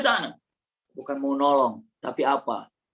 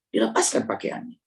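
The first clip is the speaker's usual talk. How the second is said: lower, whispered repeat; 7 semitones lower than usual. Shown as a demonstration. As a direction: drop to a hushed whisper. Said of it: "repeated in whisper".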